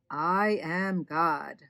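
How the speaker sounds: slow, weighty announcement; solemn voice of God. A narrator's reading. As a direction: declare it grandly, word by word. Voice from bush declares itself.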